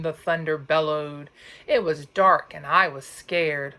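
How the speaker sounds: plain reading voice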